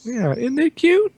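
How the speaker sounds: high, slow cooing voice; cutesy high voice. Pretend. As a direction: coo it at a puppy. Mocking cutesy voice.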